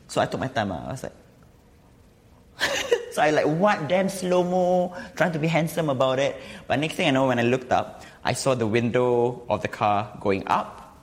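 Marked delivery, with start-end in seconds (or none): 2.6-6.4 laughing. Real, not acted. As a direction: laugh through it. Laughing at own slow-mo.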